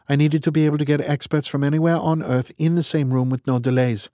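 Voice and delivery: clipped and matter-of-fact, in charge; brisk woman's voice. Acted. Strat explains, brisk.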